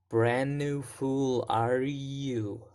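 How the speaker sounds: very slow, "brand" held longest; stress on brand. Shown as a demonstration. Teacher models the movie question slowly, stressing "brand". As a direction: stretch the phrase out, leaning on "brand". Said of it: "brand is the most stressed word".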